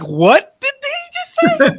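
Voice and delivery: high, slow, baffled squeak; way up high, drawn out in shock; stunned disbelief. Retold or imitated. Passenger hearing the pilot's words, shocked high squeal. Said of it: "we're like".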